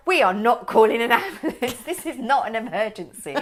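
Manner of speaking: husband refusing an ambulance, loud mock insistence; stubborn protest. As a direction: imitate the husband flatly refusing. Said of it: "Sandy's like".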